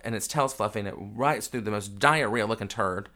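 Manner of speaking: usual speaking voice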